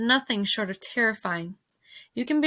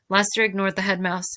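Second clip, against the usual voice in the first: usual speaking voice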